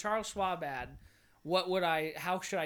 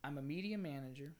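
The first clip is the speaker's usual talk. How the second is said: softer, understated tagline read; too quiet; 3 semitones lower, 6 dB quieter than usual. Real, not acted. Job seeker rehearsing his video intro, too quiet. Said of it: "That's too quiet".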